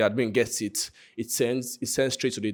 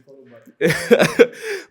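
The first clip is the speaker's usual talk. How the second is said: loud high laugh; 10 dB louder than usual. Real, not acted. Laughs at own joke.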